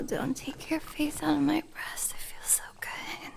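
hushed and flustered; aroused whisper. Acted. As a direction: let it slip out in a whisper. Soft whisper.

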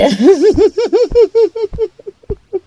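drawn-out, gloating villain laugh. Acted. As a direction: laugh in triumph at the trapped victim. Villain gloats over dead end.

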